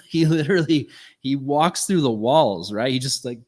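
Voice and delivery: ordinary talk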